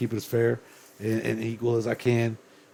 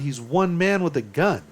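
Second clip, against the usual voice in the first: ordinary delivery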